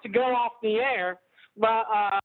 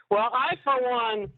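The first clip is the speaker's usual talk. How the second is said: ordinary talk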